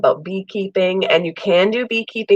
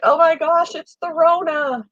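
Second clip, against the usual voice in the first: high mock alarm; mock panic; 11 semitones higher than usual. People panicking over her summer cold as covid. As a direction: play up the panic. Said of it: "now it's like".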